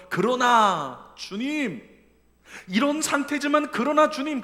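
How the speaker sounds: loud, slow, high cry of faith; fervent cry. Acted. Psalmist lifting his eyes to God, loud fervent cry. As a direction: call out to the Lord with all your heart.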